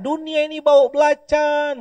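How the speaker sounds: high, drawn-out exasperated cry; mock yell. Man leaving his house blames the whole world, yelling. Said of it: "dia teriak".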